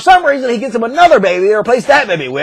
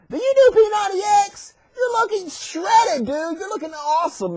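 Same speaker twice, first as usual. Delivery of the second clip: admiring lion; 8 semitones higher than usual. Acted. Lions complimenting Daniel on being shredded, admiring.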